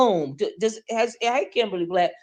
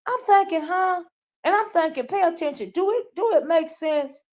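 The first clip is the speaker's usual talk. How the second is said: much higher, baffled huh; high-pitched disbelief; incredulous; 9 semitones higher than usual. Real, not acted. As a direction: act stunned at the nonsense. Reacting to being called Miss [name].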